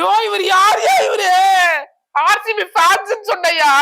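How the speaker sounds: high, loud and slow, wailing in disbelief; exasperated outburst. Acted. Fed up with the interviewer's RCB question, loud wailing outburst.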